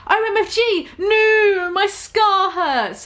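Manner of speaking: high pained scream, slowing on the end. Acted. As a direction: cry out as the scar burns. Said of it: "He started to scream".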